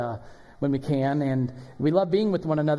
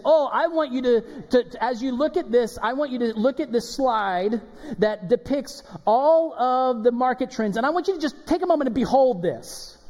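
hammed-up meeting presenter, higher and eager; mock presenter; 7 semitones higher than usual. Mock office worker presenting market trends, hammed-up. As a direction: play a keen businessman pitching slides. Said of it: "you said".

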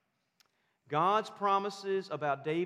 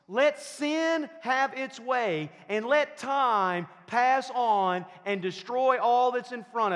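high, loud, ringing sermon climax, every line hammered; thundering pulpit delivery, slowing on the big words; full-force preaching; 5 semitones higher, 6 dB louder than usual. Acted. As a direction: preach it out, building to a rousing peak. Preaching climax on God's promises.

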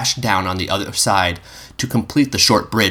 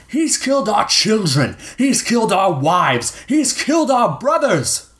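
1 grieving accuser; 13 semitones higher than usual. A narrator's reading. Leader lists grievances.

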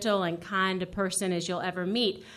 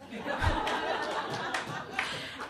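laugh; 9 semitones higher, 7 dB quieter than usual. Real, not acted. Laughing at her own joke.

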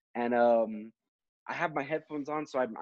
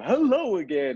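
high, loud radio greeting; radio host voice; 6 semitones higher, 6 dB louder than usual. Retold or imitated. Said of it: "you've gotten the voice down perfect".

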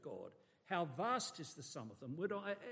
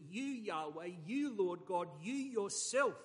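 each "you" hit louder and building; emphatic you; 2 semitones higher, 6 dB louder, slower than usual. Shown as a demonstration. Preacher pressing each "you" on the congregation, building louder. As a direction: pile weight on every "you". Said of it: "and the emphasis here is".

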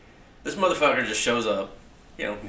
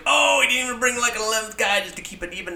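mock fan outrage; 13 semitones higher, 4 dB louder than usual. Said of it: "you're just like".

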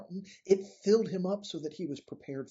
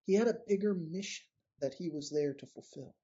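ordinary talk, nothing marked

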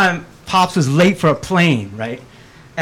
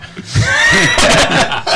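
high, loud cackling laugh; loud burst of laughter; 6 dB louder than usual. Real, not acted. Telling the pilot bribe story, loud burst of laughter. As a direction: crack up at your own punchline.

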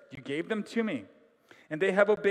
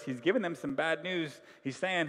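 ordinary talk, nothing marked

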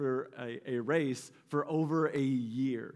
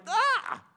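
frustrated cry; 5 dB louder than usual. Retold or imitated. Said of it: "we're like".

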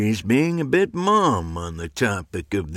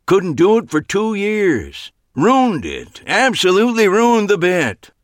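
disgusted; 12 semitones higher, 6 dB louder than usual. A narrator's reading. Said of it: "shook his head in disgust".

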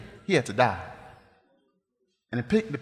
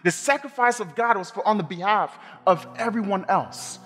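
raised, driving sermon voice, slowing on the key phrases; preaching at full force; 5 semitones higher than usual. Acted. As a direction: build the message like a revival preacher.

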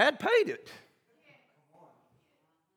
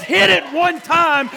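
loud, high, slow shout to the band; shouted cue; 5 semitones higher, 7 dB louder, slower than usual. Acted. Father cues band to play, loud shout.

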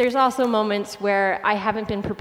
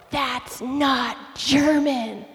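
very slow, drawn-out accusation; imitated kid's slow call-out; accusing boy; 3 semitones higher, much slower than usual. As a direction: stretch every word as a mock accusation. Imitates boy calling out her fake German.

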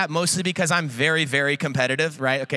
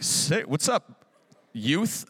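cool professor; 4 semitones lower, much slower than usual. Retold or imitated. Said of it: "professor cross you and be like".

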